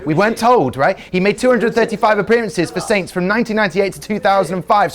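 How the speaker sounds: ordinary talk, nothing marked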